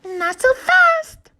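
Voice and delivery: very high, loud, slow hen cluck. A narrator's reading. Hen stopping the greedy animals, slow high cluck. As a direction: cluck it slowly, wagging a finger.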